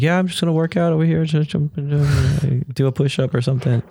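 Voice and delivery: missionary fakes a cheerful excuse to his companion, hurried and bright; mock casual cover-up. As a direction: brush it off like nothing's wrong. Fakes cheer to hide being unwell.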